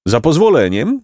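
donkey calling out. A narrator's reading. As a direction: cut in, pompously polite. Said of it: "zawołał Patałłach".

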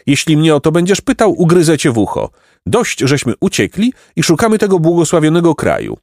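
put-on high-pitched, prickly boy; defiant runaway boy. A narrator's reading. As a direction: snap back, then declare the dream of idleness.

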